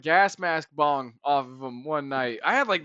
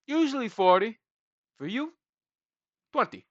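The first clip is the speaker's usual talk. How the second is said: lightly put-on Indian accent, rising and higher; a weak attempt at an Indian accent, salesman's offer; 6 semitones higher than usual. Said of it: "I can't do Indian accents. That's my best there".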